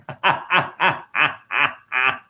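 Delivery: drawn-out run of separate ha-has; staccato laugh. Pretend. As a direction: laugh in spaced bursts, stretching the last. Laughs at his own wordplay.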